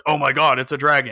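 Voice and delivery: loud imitation of an excited game character; mock alarm. Game characters announcing a dragon, mock alarm. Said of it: "than if they're like".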